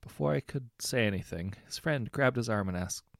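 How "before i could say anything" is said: usual speaking voice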